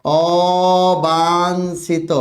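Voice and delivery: অবাঞ্ছিত drawn out syllable by syllable, very slow; teacher stretching অবাঞ্ছিত slowly so students catch each letter; slow spelling demo. Shown as a demonstration. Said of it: "দেখো".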